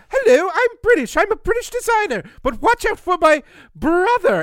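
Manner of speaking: loud, high hammed-up British lady voice; exaggerated mock British accent. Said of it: "And she's like".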